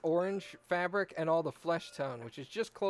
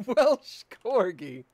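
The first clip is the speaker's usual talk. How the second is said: laughing, much louder and slow through "Welsh Corgi"; 5 semitones higher, 11 dB louder, much slower than usual. Real, not acted. Friend laughing while echoing the movie joke. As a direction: crack up while repeating it.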